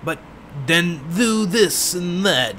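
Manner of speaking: slow, drawn-out mock old lady; lightly hammed-up old lady, dragging the words; another old lady type. Shown as a demonstration. Gamer showing another kind of old lady, slow mock voice. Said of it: "And then there are some that are like".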